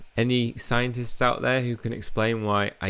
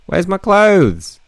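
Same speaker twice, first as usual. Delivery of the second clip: high, loud, slow mock panic; hammed-up panicked squeal; mock shriek; 11 semitones higher, 10 dB louder, much slower than usual. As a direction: wail it in comic alarm. Mock panic over missing outfit.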